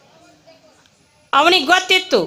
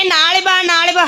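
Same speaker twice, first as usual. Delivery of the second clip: brushing him off; 6 semitones higher than usual. Retold or imitated. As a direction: put him off again and again, ಬಾ stressed. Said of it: "ಹಿಂಗೆ". (ಉದಾಸೀನವಾಗಿ ಮುಂದೂಡುವ ಧ್ವನಿ)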